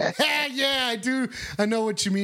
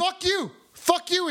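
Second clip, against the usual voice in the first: higher, heated comeback; angry retort; 7 semitones higher, slower than usual. Acted. Pizza guy snaps at the rude caller, heated and high. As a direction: fire it back at him.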